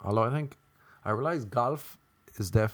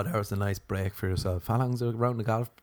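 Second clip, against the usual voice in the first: normal talking voice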